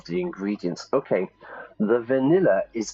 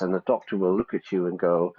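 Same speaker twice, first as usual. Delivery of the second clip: ordinary talk, nothing marked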